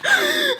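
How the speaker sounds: high, sucked-in mock gasp; resurrection gasp. Friend coming to after fainting, sudden high gasp. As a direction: gasp like coming back from the dead. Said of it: "like, being resurrected".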